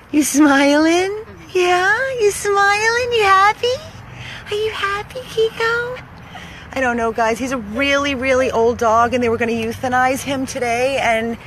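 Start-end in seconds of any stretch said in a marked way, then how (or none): 0.1-6.0 high, sing-song questions to the dog. Real, not acted. Rescuer coos at the happy dog in a high voice. Baby talk to smiling dog.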